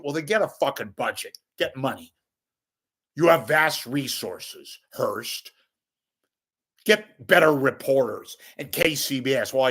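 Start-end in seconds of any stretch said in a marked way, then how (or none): none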